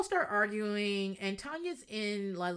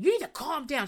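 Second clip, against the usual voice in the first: mock yell; 8 semitones higher, 6 dB louder than usual. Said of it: "is yelling".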